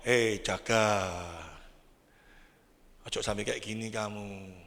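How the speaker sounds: God speaking to the husband in a low, slow warning. Retold or imitated.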